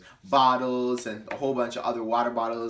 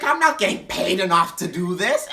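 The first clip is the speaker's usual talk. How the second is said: higher, louder whiny complaint; drunk whine; 4 semitones higher, 5 dB louder than usual. Retold or imitated. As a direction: whine about the pay. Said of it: "her voice sounded just like that".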